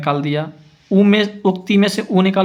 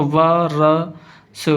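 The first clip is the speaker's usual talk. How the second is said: slow sounding-out; much slower than usual. Shown as a demonstration. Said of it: "इसमें मिलाएंगे तो वर्ष".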